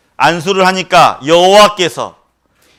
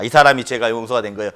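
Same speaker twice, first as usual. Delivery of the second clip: normal talking voice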